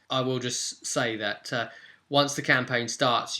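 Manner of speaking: ordinary talk, nothing marked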